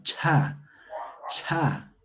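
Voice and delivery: "cha" released with audible breath; aspirated CHA. Shown as a demonstration. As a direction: say "cha" as if blowing. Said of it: "Como soplando".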